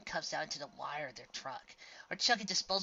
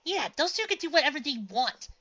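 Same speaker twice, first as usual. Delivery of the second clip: indignant; 13 semitones higher, 10 dB louder than usual. A narrator's reading.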